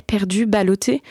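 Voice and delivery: ordinary delivery, nothing marked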